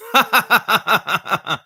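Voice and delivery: short chuckle after the punchline; amused laugh. Real, not acted. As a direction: laugh at your own corny joke. Laughs at own joke.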